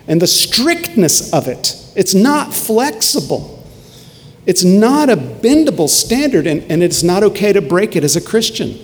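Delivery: high, loud preaching push; fervent preaching. Acted. Pastor pressing his congregation, preaching at full force. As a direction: preach it with rising fire.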